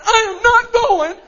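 put-on very high, loud kid's refusal; defiant child yell. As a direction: scream it like a stubborn boy.

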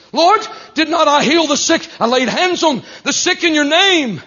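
loud, high protest to the judge; desperate self-defence. Acted. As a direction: argue frantically for your soul. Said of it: "trying to convince the judge".

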